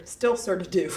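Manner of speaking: ordinary delivery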